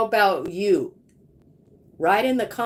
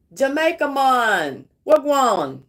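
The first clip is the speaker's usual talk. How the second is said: playful mock Jamaican accent, louder, wagwan punched out; 4 dB louder than usual. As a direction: greet them in a hammed-up Jamaican accent. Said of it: "My best Jamaican accent".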